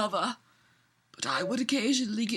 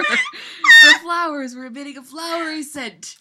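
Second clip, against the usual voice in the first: louder, laughing as she reads; laughing through it; 2 semitones higher, 7 dB louder than usual. Real, not acted. Cracking up reading absurd fanfic to a friend, laughing. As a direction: push the words out through laughter.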